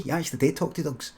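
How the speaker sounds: usual speaking voice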